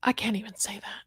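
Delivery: soft, breathy whisper, fighting emotion; choked-up whisper. Real, not acted. Vlogger overcome with anger, whispering to viewers. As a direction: barely get the words out. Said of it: "I'm getting choked up".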